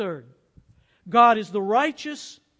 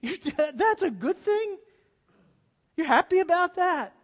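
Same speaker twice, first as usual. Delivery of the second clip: incredulous mock; 6 semitones higher than usual. Mocking the atheist's view.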